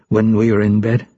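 ordinary delivery, nothing marked

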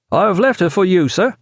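high-pitched call to the captain; calling across deck. A narrator's reading. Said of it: "called Pullings across the prize's deck".

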